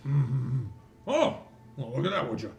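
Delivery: lightly voiced, intrigued constable; musing dwarf constable. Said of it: "he's like".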